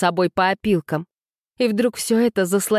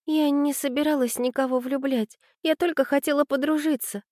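put-on high, flustered girl's voice; bewildered young girl's excuse; 9 semitones higher than usual. A narrator's reading. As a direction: explain yourself helplessly, high-pitched. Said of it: "пробормотала Аня растерянно".

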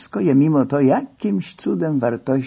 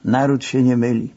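ordinary delivery, nothing marked

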